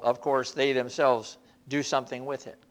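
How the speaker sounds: normal talking voice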